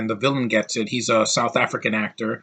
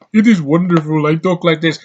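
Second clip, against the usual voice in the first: mock African tribesman accent, pitched up and loud; 5 semitones higher, 6 dB louder than usual. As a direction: imitate a movie's caricatured African accent.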